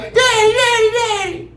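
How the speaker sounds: very high, wailing Daddy; little daughter sobbing in the principal's office; crying little girl. Retold or imitated. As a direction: cry it like a kid running to dad. Daughter runs crying to dad.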